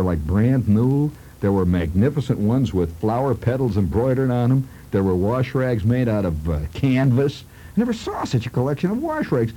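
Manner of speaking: usual speaking voice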